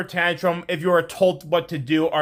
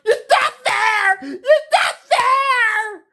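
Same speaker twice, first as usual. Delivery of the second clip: very high drawn-out mock wail; whining complaint; 13 semitones higher, much slower than usual. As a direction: imitate a tantrum, slow and squealing. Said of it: "and complain, like".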